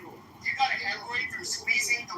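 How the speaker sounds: hammed-up Indian English caricature, needling the other guy; put-on Indian accent. Trolling a stranger online in a put-on Indian accent.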